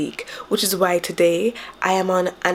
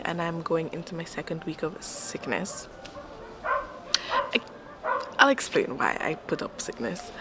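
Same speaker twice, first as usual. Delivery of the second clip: hushed whisper all through; 8 dB quieter than usual. Real, not acted. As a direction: keep it to a whisper. Whispers the whole update.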